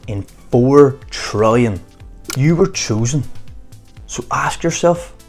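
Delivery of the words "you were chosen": usual speaking voice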